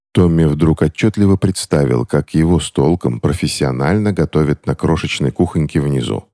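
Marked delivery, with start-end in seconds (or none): none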